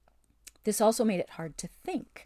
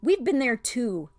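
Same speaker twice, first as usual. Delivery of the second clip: a sharp, louder, higher retort; snapped; 6 semitones higher, 7 dB louder than usual. A narrator's reading. Jill snapping back at the witch, sharp and louder. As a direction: snap it back, angry at the spell. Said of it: "snapped Jill".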